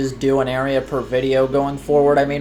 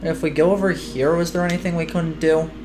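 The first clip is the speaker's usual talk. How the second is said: normal talking voice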